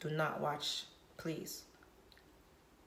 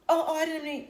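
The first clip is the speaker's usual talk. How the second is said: high, loud mock flustered denial; sudden high-pitched oh oh; flustered backpedaling; 11 semitones higher, 9 dB louder than usual. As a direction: play the caught-out man squealing his excuse. Man backpedals in retold argument.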